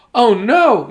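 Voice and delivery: high, loud dismayed cry; yelled. Acted. Mother when the car won't start, high loud yell. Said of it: "yelled Loretta's mother".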